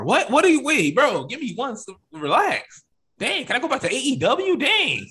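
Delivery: high, exasperated wrestler whine; a new WWE wrestler griping about the rules, mock exasperated; mock exasperation.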